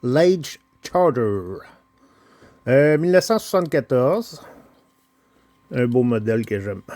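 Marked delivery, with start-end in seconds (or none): 0.0-1.6 slow, deliberate English "Large Charger"; English accent. Shown as a demonstration. To viewers, proudly saying the name in his English accent. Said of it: "Avec mon beau, bel accent en anglais".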